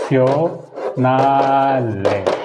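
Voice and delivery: sionale stretched out very slowly in Italian; Italian ending. Shown as a demonstration. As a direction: draw sionale out syllable by syllable. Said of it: "Italian में क्या हो गया?". (sionale बहुत धीरे इतालवी उच्चारण में)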